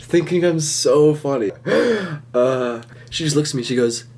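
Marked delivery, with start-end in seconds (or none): 1.6-2.3 mock gasp. Reacting to his own joke.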